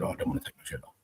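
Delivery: whispered, timid plea; unvoiced nervous recruit begging; meek whisper. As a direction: shrink away as you say it. Scared recruit pleading.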